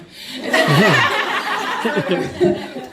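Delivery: loud embarrassed laugh breaking through tears; embarrassed teary laugh. Real, not acted. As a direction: laugh off the emotion. Tearful laugh after emotional remark.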